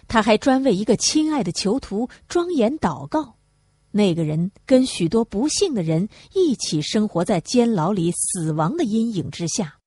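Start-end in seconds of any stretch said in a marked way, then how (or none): none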